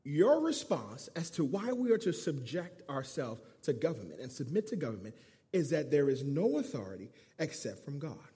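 normal talking voice

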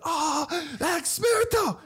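a high, slow, drawn-out "Oh, experto" from the crowd; impressed onlookers. Retold or imitated. Onlookers in the souk, impressed by the answer, high and drawn out. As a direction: stretch it out high like amazed bystanders.

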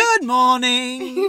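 slow, loud, drawn-out chirpy hello; sing-song greeting. Retold or imitated. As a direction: call it out cheerfully, stretched. Mimicking Iris chirping.

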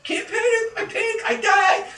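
high, tense, clipped self-warning; panicky self-talk. Retold or imitated. Bob staring down the creature, high panicky self-talk. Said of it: "He's like".

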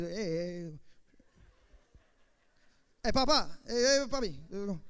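slow, loud Puerto Rican gangster voice, calling out; Nicky Cruz. Retold or imitated. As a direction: strut it like a Puerto Rican street tough, drawing out papi. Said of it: "I should play Nicky Cruz".

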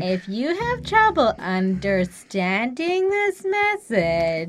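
very slow, sing-song condescension; mock a teacher spelling it out for slow listeners; patronizing teacher. Co-host mimicking the teacher's voicemail to parents, patronizing. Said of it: "she sounds like a patronizing ass person".

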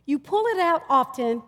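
ordinary talk

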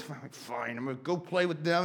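grumbling, reluctant surrender; sulky give-in. Retold or imitated. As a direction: sigh it out like a defeated kid. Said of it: "I'd always".

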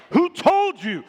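loud, high, indignant demand; challenging shout. Acted. Objector confronting a believer's authority, acted shouted challenge. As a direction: challenge them loudly, pitch jumping up.

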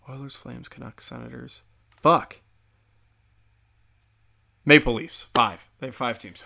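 ordinary talk, nothing marked

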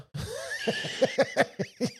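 high shrieking burst of laughter; real laughter bursting out high. Real, not acted. Explodes laughing at joke.

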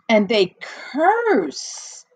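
slow, scandalised gasp; slow shocked report of cursing. Retold or imitated. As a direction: gasp then drag the words out.

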